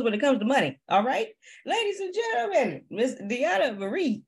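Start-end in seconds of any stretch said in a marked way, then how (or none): 1.6-4.2 high-pitched emcee build-up easing down to the name; announcer introduction. Real, not acted. Host bringing on Miss Deanna Marie, announcer style. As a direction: present the guest like a ring announcer.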